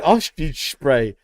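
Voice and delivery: "ostrich spray" said slowly as "oshtidge spray"; drawn-out mispronunciation. Retold or imitated. Host repeats the funny name, "ostrich spray" slurred slowly. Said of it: "Saying that makes me laugh".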